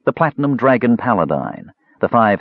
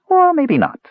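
high, quick backing-off; slightly daunted; 4 dB louder than usual. A narrator's reading. Kender stared down by the mage, high daunted retreat. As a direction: retreat with a nervous little laugh in the voice. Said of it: "slightly daunted".

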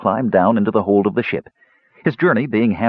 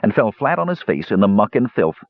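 plain reading voice

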